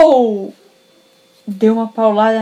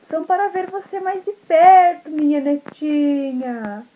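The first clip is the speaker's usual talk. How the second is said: high, slow false sweetness; wolf as sweet grandma; 6 semitones higher, slower than usual. Acted. Wolf posing as grandma about the eyes, high and sugary. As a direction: sweeten it to fool her. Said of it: "a vovó falou assim".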